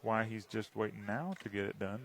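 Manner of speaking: ordinary talk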